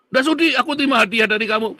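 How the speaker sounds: fast, loud, high-pitched refusal; acted raging. Pak Iman refuses the gift from the messenger, raging. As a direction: act out an indignant rejection. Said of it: "Pak Iman ini ngamuk-ngamuk".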